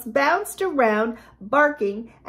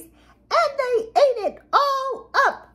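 very high, sputtering cub voice; high squeaky baby bear; 14 semitones higher than usual. A narrator's reading. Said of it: "in her high squeaky voice".

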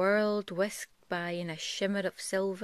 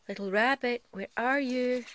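put-on louder, higher call for the lost child; 6 semitones higher, 6 dB louder than usual. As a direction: call out into the wind. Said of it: "he called".

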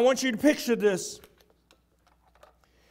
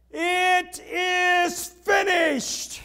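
very slow, drawn-out, higher cry; roar; 6 semitones higher, much slower than usual. Acted. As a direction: stretch every word out as a triumphant roar. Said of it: "He roars".

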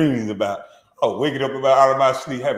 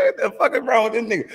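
excited mockery; 12 semitones higher than usual. Real, not acted. Mocking the podcaster's slick haircut.

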